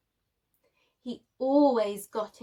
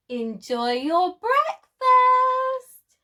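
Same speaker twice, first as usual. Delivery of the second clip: high, slow, loud mocking sing-song; gleeful teasing, drawn out; cheeky taunt; 12 semitones higher, 9 dB louder, slower than usual. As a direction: tease him with glee. Sid teasing after splatting Spike.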